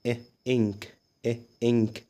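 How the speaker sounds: "إ" alone and short, then "إنك"; short I demo. Shown as a demonstration. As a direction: sound out a brief ih before "إنك", pausing between. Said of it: "زي مثلًا عندنا كلمة إنك". ("إ" قصيرة ثم "إنك")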